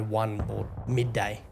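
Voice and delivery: ordinary talk, nothing marked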